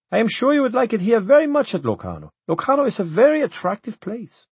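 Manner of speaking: pompous official. A narrator's reading. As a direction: sell Locarno, voice pushed up high.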